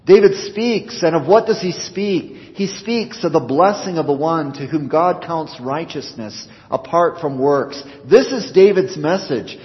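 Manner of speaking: rising fervent preacher's cry; preaching at full force. Acted. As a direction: drive the message home from the pulpit. Sermon climbs to full force.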